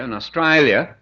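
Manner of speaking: Australia said the Australian way; broad Australian vowel on Australia; Australian accent. Shown as a demonstration. Showing the audience how locals say it, Australian accent. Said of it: "the Cockney accent".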